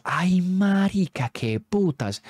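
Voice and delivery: slow, drawn-out frightened cry; terrified gasp. Retold or imitated. Boys spooked by the ghost program, drawn-out terrified cry. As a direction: let the fear stretch the word.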